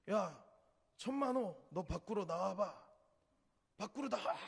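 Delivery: retold shouting. Drunk man yelling at Manho to step outside, loud challenge. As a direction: call him outside, picking a fight. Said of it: "소리를 지르는 거예요".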